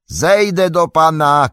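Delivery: high, slow, loud call; determined drawn-out yell; shouting. A narrator's reading. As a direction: shout it down to someone below. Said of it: "krzyknął Sam".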